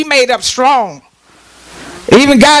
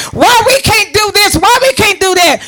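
mock whining; 7 semitones higher than usual. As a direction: whine it like a kid told no. Congregation mimicked whining like kids.

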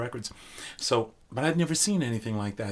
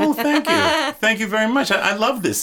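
delighted; 12 semitones higher, 6 dB louder than usual. Real, not acted. Thanking host for compliment.

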